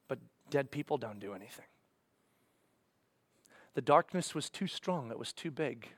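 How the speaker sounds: low, soft, defeated voice; somber hushed telling. Acted. Preacher telling of the death on the cross, low and defeated. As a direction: sink into hopelessness, fading toward a whisper.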